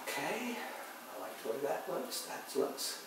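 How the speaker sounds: soft, half-whispered approval to yourself; muttered to himself. Real, not acted. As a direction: mumble it under your breath as you paint.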